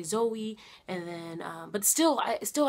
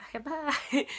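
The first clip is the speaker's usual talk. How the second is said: imitated nurses' goodbye; 7 semitones higher than usual. Imitates nurses' cheery send-off.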